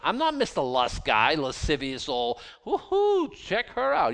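high, loud leering voice with a whoop; mock lecher. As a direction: ham up a leering old man. Parodying a leering lustful man.

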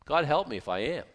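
usual speaking voice

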